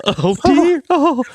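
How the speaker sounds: high, scandalised falsetto; mock-horrified prim voice; pearl-clutching lady. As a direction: gasp like a shocked church lady. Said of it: "joins the pearl-clutching impression".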